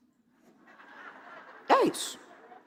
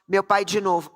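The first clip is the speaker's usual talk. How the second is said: normal talking voice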